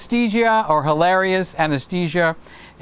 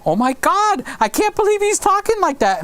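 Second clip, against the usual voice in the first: shocked mother; 13 semitones higher, faster than usual. Retold or imitated. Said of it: "their mothers are gonna go like".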